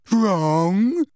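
loud, high, outraged echo; throbbing with emotion. A narrator's reading. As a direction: cry it out, swooping upward in disbelief. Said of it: "cried Professor Trelawney in a voice throbbing with emotion".

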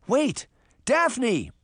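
high call after a sister; anxious high-pitched shout; urgent cry. A narrator's reading. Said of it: "Sabrina cried".

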